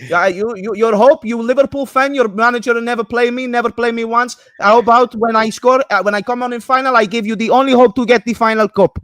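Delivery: high-pitched, indignant mock Italian; Italian accent. Neglected player berating a Liverpool fan, mock Italian accent. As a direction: complain in a hammed-up Italian accent. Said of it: "that doesn't sound Italian".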